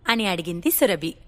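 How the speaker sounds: usual speaking voice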